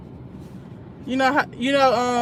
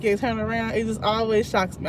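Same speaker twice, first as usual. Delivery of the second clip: usual speaking voice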